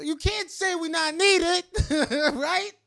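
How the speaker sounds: high, loud, slow teasing dare with a laugh; teasing challenge through laughter. Real, not acted. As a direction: laugh it out as you dare them.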